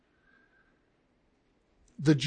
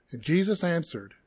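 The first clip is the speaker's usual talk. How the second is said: ordinary talk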